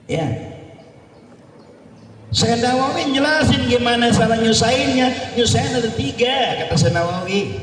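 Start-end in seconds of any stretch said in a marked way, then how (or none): 2.3-6.6 higher, raised preaching voice; emphatic declaration. Real, not acted. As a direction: announce the three points. Preacher declaring point loudly.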